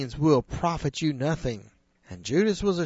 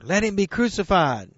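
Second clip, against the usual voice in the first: a louder mob cry, pitched higher; 5 semitones higher, 6 dB louder than usual. Acted. Preacher voicing the crowd's louder cry. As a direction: push it harder, the crowd insisting. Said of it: "And they cried out louder".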